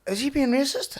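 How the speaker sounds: high, loud imitation of an incredulous mate; mock shocked question. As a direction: squeal it out like a scandalised friend. Retelling mate's shocked question.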